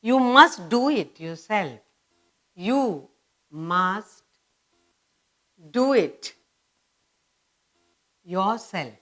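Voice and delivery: slow classroom dictation in separated chunks. Shown as a demonstration. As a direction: dictate phrase by phrase, leaving time to write. Teacher slowly dictates exercise answers.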